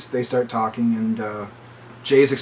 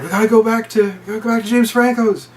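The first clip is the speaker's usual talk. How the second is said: panicked; 9 semitones higher than usual. Retold or imitated. Panicked friend urging.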